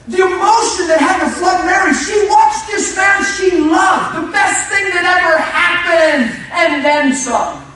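loud, high shouted preaching, slowing on the key lines; preacher shouting the story of Mary's grief; full-force preaching. Acted. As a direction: pour it out to the congregation at full volume.

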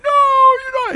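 high loud insistent no; emphatic denial. Retold or imitated. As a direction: cut her off loudly. Retold altar exchange.

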